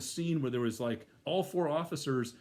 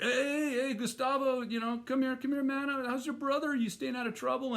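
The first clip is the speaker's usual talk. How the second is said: calling across the lot, pitched much higher; 11 semitones higher than usual. Retold or imitated. Cop calling out to a known guy leaving the store, high and carrying. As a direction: hail him from a distance, friendly but firm.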